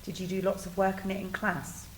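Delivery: ordinary delivery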